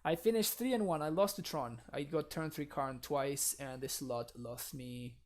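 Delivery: normal talking voice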